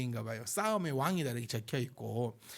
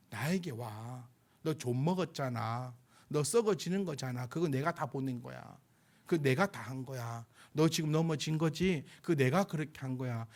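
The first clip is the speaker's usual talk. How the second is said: voicing God; 3 semitones lower than usual. God calling the fallen to come back, low and tender. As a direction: speak low and gently, as God reassuring. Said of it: "하나님의 그 음성은 뭡니까?".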